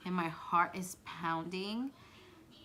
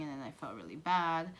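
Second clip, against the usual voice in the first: usual speaking voice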